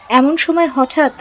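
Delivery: plain reading voice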